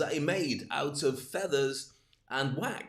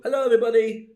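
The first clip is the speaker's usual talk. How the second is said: loud, high cheery call; 8 semitones higher, 12 dB louder, faster than usual. Pretend. Neighbour calling out from his doorstep, loud. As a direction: shout the greeting out the door. Sticking head out door, calling.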